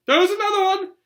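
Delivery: very high, loud shout; boy shouting from the hall about the letter. Acted. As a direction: call it down the hall at full voice. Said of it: "Then he shouted".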